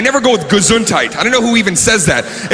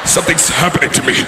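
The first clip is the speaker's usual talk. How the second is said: man turning werewolf; 3 dB quieter, faster than usual. Acted. Warning someone away before a sneeze, hint of werewolf. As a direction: strain it out as if starting to transform. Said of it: "about to turn into a werewolf".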